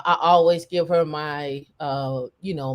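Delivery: normal talking voice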